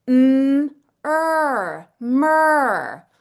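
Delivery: "m", "er", then "mer", each sound split apart and then blended. Shown as a demonstration. Mom sounding out mer for her daughter, sound by sound. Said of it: "The first part of that word is mer".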